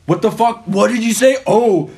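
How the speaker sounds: high, indignant retold challenge; defensive. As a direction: act out a friend taking offense, accusing. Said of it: "he got all defensive".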